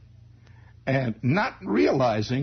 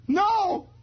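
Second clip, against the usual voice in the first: high squeaky denial; boy denying to his father, high; kid's shout. Retold or imitated. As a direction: yelp it high. Kid denies to father.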